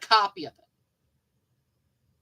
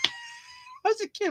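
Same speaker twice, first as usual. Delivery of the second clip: squeaky high laugh through the words; 7 dB quieter, slower than usual. Real, not acted. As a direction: giggle it out in a squeak.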